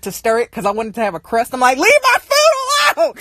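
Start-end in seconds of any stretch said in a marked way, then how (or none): 1.8-3.1 high-pitched outburst; imitated sibling-quarrel yell; mock exasperated yell. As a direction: cry out in acted exasperation, pitched way up. Acted quarrel over her food.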